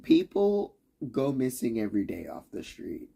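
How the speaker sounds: ordinary talk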